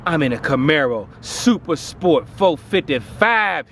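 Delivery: ordinary talk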